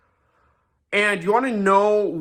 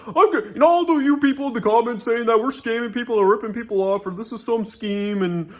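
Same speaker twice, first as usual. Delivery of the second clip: higher, mocking whine of an offended host; pitched-up sarcastic imitation of an annoyed man; mocking butthurt Pat; 4 semitones higher than usual. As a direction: mimic a sulky, defensive complainer, pitched up. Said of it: "Pat proceeds to get butthurt and angry".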